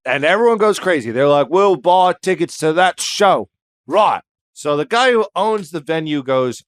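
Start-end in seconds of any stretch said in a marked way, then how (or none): 1.5-3.5 excited put-on English accent; mock English accent. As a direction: play an eager English punter. Acts excited English crowd.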